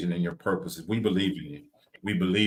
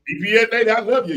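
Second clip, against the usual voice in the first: teasing call-out; 12 semitones higher, 6 dB louder, faster than usual. Acted. Teasing call-out to DPS lady.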